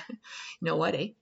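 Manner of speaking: put-on quick, jokey go at a Canadian accent; badly faked Canadian; mock Canadian accent. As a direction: play up a Canadian accent, not quite right. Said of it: "what is that accent?".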